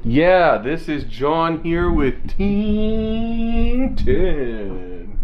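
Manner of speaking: high mock vlogger with a long-stretched ending; put-on vlogger voice. John introducing Team ten to viewers, put-on vlogger voice. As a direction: ham up a vlogger intro, dragging out the team name. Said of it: "keep doing the voice".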